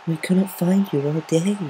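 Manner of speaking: high, slow, gently concerned voice; timid pony, softly worried; Fluttershy soft voice. Acted. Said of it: "her soft voice concerned".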